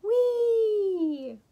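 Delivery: long high whee; drawn-out high squeal sliding down; gleeful yell. A narrator's reading. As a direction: yell it out with joy. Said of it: "He yelled".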